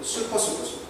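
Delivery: normal talking voice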